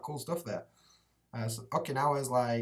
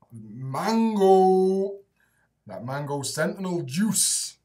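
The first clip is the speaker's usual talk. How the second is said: loud, high, stretched mango; drawn-out mango; 4 semitones higher, 8 dB louder, much slower than usual. Real, not acted. Streamer hyping his vape flavour, loud and stretched. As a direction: sell the flavour name with a flourish.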